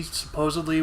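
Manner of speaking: ordinary talk, nothing marked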